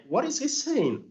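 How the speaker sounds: high-pitched mock bewilderment; baffled listener. Listeners reacting to an unclear accent, imitated bafflement. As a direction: ask it high, as if you can't follow.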